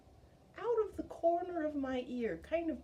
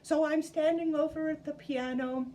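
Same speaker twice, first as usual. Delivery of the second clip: ordinary talk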